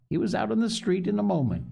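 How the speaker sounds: plain reading voice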